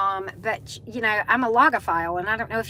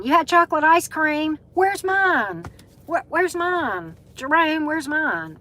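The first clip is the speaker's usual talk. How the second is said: high, louder mock-indignant teasing; playful mock demand; 7 semitones higher, 4 dB louder, slower than usual. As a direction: jokingly demand your share, pitched up. Playfully demands viewer share ice cream.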